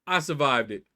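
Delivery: usual speaking voice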